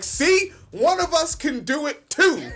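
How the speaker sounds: high, loud mock cheer. Imitating relieved fans crowing over [name]. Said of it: "They were like".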